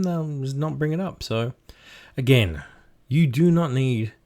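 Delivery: normal talking voice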